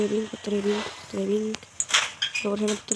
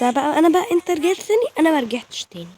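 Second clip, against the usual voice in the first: mocking retort; 5 semitones higher, 5 dB louder than usual. Real, not acted. Mocking the returning enemy.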